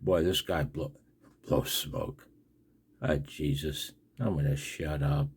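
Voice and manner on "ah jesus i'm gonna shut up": ordinary talk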